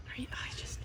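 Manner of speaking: breathless awed whisper; hushed so as not to scare it. Real, not acted. Admiring the monkey's tail, whispered.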